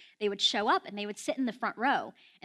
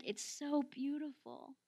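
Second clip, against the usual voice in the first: soft, slow, slightly higher wonder; a touch of tearful amazement, hushed; imitated tearful awe; 4 semitones higher, 9 dB quieter, slower than usual. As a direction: retell being moved to tears, gently. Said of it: "Graham started crying".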